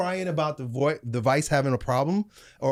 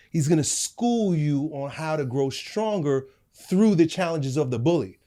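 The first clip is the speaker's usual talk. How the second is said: ordinary delivery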